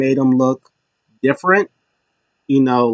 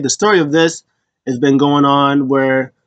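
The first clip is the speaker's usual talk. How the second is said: normal talking voice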